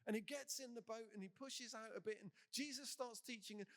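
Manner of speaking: ordinary talk, nothing marked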